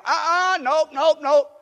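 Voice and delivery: loud, high emphatic refusals; Peter refusing. Acted. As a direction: shake it off loud and high, nope nope nope. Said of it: "Peter refusing, voiced".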